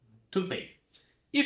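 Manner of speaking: usual speaking voice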